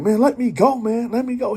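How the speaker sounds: high, loud frantic plea to be let go; panicked plea. Retold or imitated. Grabbed girl pleading to be let go, high and frantic. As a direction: cry out to get free of his grip.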